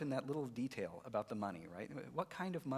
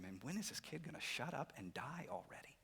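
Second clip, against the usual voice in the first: whispered mock grumble; muttered annoyance; 8 dB quieter than usual. Brothers thinking aloud over lunch, hushed and fed up. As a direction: grumble it under your breath.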